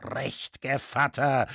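plain reading voice

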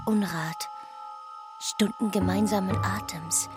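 usual speaking voice

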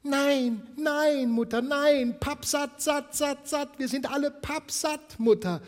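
put-on very high, loud excited kids' voices; chorus of small children, all full; little ones squealing they are stuffed; children calling out. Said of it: "riefen die kleinen Cratchits durcheinander".

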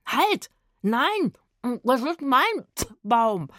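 high, loud protest, slowing on the claim; possessive shouting. A narrator's reading. Rufus keeps his friends off his cherry tree, possessive shouting. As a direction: fend them off loudly and greedily.

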